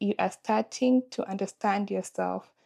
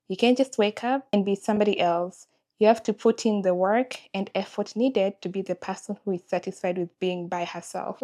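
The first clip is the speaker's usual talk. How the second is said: usual speaking voice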